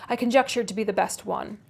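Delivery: ordinary delivery, nothing marked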